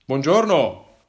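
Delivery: dog owner calling out to the hiker; man calling out. Acted. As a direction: hail a stranger from afar. Man calls from a distance.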